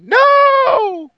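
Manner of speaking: very high, loud shouted refusal; a mock gatekeeper shouting no; gatekeepers' no. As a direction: bark it as a gatekeeper blocking the door. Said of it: "the gatekeepers are saying".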